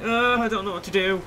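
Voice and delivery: high, loud, hammed-up cluelessness; mock confused machine. Player voicing the timer past an hour, mock confusion. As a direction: imitate a timer getting flustered. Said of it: "it gets all like".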